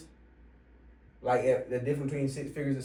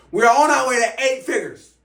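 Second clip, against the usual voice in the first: much higher and louder, a rallying roar; hype shout; 11 semitones higher, 11 dB louder than usual. Real, not acted. Coach firing up viewers about eight figures, rallying shout. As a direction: belt it out like firing up a crowd.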